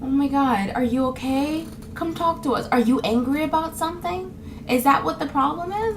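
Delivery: high, softened mock concern; concerned onlooker. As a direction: imitate someone fussing over a sad person. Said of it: "they'll think".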